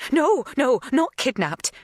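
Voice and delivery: high, loud no, no, tumbling out; hasty denial. A narrator's reading. Magda hastily denying the kidnapping, loud high protest. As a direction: rush to correct her.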